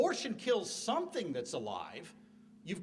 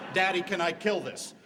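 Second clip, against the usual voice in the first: a louder hint of a small boy asking daddy; lightly voiced child; 8 dB louder than usual. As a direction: play the kid holding his brother, eager. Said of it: "when he's asking the question".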